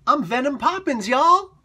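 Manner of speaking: high, loud, laughing punchline; cracking himself up while joking about the page; joking announcement. Pretend. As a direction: belt it out as a goofy joke. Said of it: "I'm making a joke".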